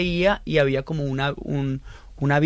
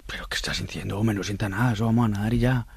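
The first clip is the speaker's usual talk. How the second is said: lower, softer voice of an unbothered father; dismissive dad; 6 semitones lower, 4 dB quieter than usual. Retold or imitated. Son quotes his swimmer father's reply, low unbothered voice. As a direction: brush it off in a low, quiet dad voice.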